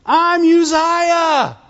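slow, drawn-out high boastful shout; proud shout. Retold or imitated. Preacher shouting as King Uzziah to the congregation, drawn out. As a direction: bellow the name like a proud king.

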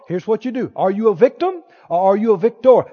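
usual speaking voice

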